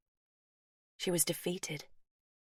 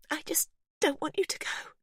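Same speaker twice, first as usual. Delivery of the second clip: high, cracking plea; voice breaking; 11 semitones higher than usual. Acted. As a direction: let it crack as she begs. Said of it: "her voice breaking".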